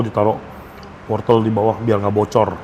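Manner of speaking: usual speaking voice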